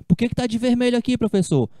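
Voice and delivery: high, fast mock student asking; lightly voiced pupil asking the teacher; student question. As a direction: play a curious student, quickly.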